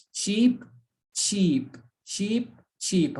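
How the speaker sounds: "sheep" repeated with pure sh, alternately rising and falling; pronunciation drill. Shown as a demonstration. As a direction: drill "sheep" in separate words, up then down. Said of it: "But here we say sheep".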